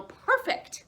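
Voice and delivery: high, loud delighted exclaim; excited cheer. A narrator's reading. Narrator cheering the idea, high excited exclaim. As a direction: cheer the find.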